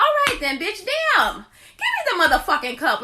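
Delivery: loud, high, exasperated snap at the nurse; sassy retold outburst, loud and high; mock outburst. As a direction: blow up at her, half laughing.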